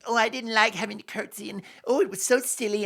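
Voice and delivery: mocking imitation, pitched a bit up; lightly voiced mock gushing about a curtsy; Meghan. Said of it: "like Meghan did when she mocked".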